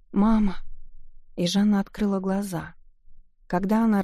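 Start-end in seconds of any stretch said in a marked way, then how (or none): 0.1-0.6 high, loud panicked cry; frightened cry for mother. A narrator's reading. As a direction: call out for mother in terror. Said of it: "взволнованно лепеча".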